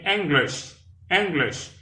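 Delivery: English said /enɡleʃ/, first syllable EN stressed, high and clear; two clear syllables EN-glish; model pronunciation. Shown as a demonstration. Teacher modelling English for French learners. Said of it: "on dit songer tout petit".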